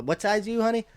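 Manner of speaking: normal talking voice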